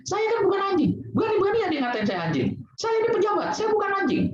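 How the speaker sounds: high-pitched indignant whine of a proud man insulted; offended official. Retold or imitated. Speaker mimicking an insulted official, hammed-up indignant rant. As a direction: play the pompous official outraged at being called a dog. Offended person imitated again.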